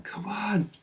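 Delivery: high-pitched, held-back victory yell; cheering yourself on, pitch leaping up; retold hyped shout. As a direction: re-enact a pumped-up shout without full volume. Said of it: "Started shouting".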